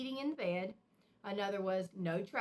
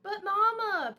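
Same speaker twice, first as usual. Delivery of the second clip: protesting; 10 semitones higher, 9 dB louder, slower than usual. A narrator's reading. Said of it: "protested sister".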